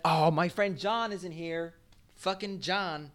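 high, soft mock pining; drawn-out little complaint, high and quiet; mock disappointment. Bemoaning an absent friend John, mock disappointed.

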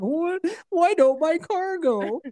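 high, slow dopey voice; high slow mock bewildered driver; mock confused driver. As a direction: play a clueless driver spinning out. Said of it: "but you're like".

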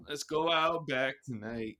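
slow put-on Australian accent; drawn-out mock Aussie line. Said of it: "Australian's pretty good".

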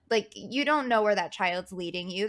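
ordinary talk, nothing marked

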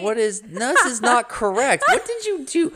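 high, loud disbelief; shocked outrage. Real, not acted. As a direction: squeal in mock horror at the mistake. Shocked at wrong quote.

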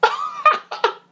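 high, laughing "ah" yelp; quick high-pitched mock cry of alarm; startled yelp. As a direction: re-enact a sudden startled cry. Acting out his startled reaction.

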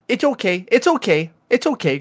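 high soothing mock reassurance; mock soothing daughter. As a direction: imitate the kid calming dad down, pitched high. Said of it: "she'll be like".

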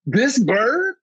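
high, slow, thrilled rising question; excited disbelief. Retold or imitated. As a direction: stretch it out in happy disbelief. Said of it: "I started freaking out".

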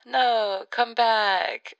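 high, loud plea through a telephone filter; calling back, filtered. Pretend. Voice calling the podcaster back after bye, telephone sound. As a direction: call after someone leaving, down a phone line.

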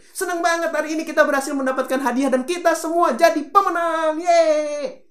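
high, loud, fast joyful cheer ending in a shout; thrilled winner's celebration; excited. Shown as a demonstration. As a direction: burst with victory joy. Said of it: "Ngomong cepet dong".

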